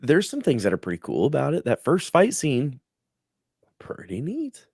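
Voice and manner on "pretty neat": soft whisper; whispered praise. Real, not acted. Host quietly praising the first fight scene, whispered. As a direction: breathe it out under your breath.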